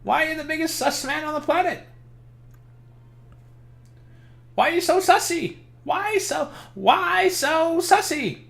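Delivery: high-pitched squeaky voice all through; Bailey impression. Streamer mocking Ford as Bailey, high squeaky voice. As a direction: crank the pitch way up to mock Bailey. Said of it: "I have to do my best Bailey impression".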